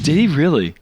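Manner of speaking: high, disbelieving question; can't believe the near miss; surprised. Real, not acted. Disbelief at pole hit.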